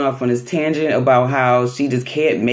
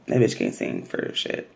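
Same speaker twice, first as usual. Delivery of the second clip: much lower and softer put-down; muttered dig; 8 dB quieter than usual. Real, not acted. Host dissing Betty's singing, muttered under breath.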